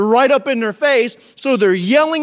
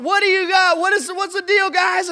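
mock yell, half awake; 9 semitones higher than usual. Jesus calling out to the disciples, groggy mock yell. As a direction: call out over the storm, still drowsy. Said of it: "he yells this out".

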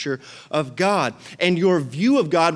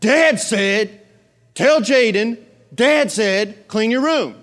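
higher, louder sibling order; mock yell; dad-backed order; 7 semitones higher, 5 dB louder, much slower than usual. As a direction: invoke dad's authority. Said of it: "But if Dad yells".

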